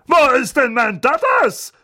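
high, loud fussing aunt voice; Aunt Magda booming. Acted. Aunt calling for her darling nephew, loud and fussing. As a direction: bellow fondly for her nephew. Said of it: "rührte Tante Magda".

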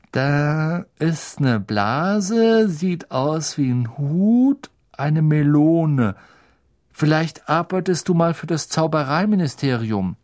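high, very drawn-out schoolboy voice; Ron peering and guessing. Acted. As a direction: stretch the words while working out the shapes. Ron deciphers the cup. (Ron, hoch und grübelnd gedehnt)

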